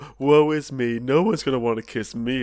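mock the moping nice guy; hammed-up woe-is-me whine; whiny nice guy. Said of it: "the nice guys are all like".

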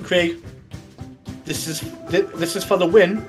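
ordinary talk

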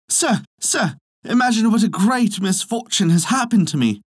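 high-pitched feigned distress; troubled cry. A narrator's reading. Swineherd reporting lost swine to the farmer, troubled cry. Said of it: "ran in great trouble to the farmer and cried".

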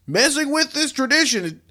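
hammed-up official statement, building to indignation; indignant state vet, rising to a shouted finish; quoting an official. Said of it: "And he said, quote".

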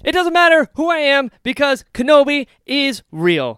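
loud, high, bursting with joy; overjoyed. Real, not acted. Fan telling co-host Kenobi is real, loud and overjoyed. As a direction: announce it like the best news ever.

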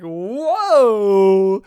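loud startled exclamation; startled whoa. Retold or imitated. Viewer startled by the screaming lady, loud whoa. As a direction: jump back in surprise.

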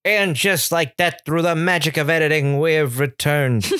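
announcer return. Acted. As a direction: announce the comeback like a TV host. Host announces return after edit.

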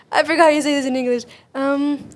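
ordinary delivery

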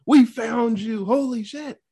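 high, slow exclaim of finding her; relieved cry of we found you; excited discovery. Retold or imitated. Dad finds lost daughter, excited.

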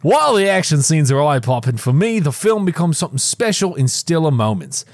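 put-on reads the review in an Australian accent; a light Aussie accent for the critic quote. Podcast host reads a critic's review in an Australian accent. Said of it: "That was a good accent, [name]".

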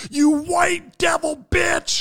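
high, slow accusing yell; hammed-up cry from someone in a crowd; heckler's shout. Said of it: "someone out of the crowd goes".

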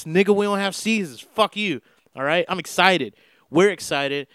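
normal talking voice

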